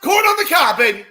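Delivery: very high, loud shout; goal celebration. Real, not acted. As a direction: whoop it out after the goal.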